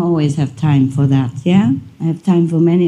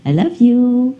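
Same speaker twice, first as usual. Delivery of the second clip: lightly raised sweet-talk to pets; cooing to the dogs; 6 semitones higher, slower than usual. Retold or imitated. A hint of cooing at the dog people at bedtime. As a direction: coo it like talking to a beloved dog. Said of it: "I say".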